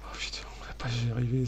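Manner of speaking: soft, whispery despair at the game; whispered dismay. Real, not acted. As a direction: whisper it as if doomed. Découragé pendant le combat. (chuchotement découragé)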